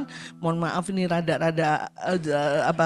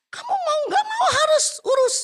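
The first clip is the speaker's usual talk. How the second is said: high-pitched stern order, acted out; scolding employer; 5 dB louder than usual. Employer orders the frightened maid to handle the dog, stern and high. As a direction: act a bossy employer laying down orders.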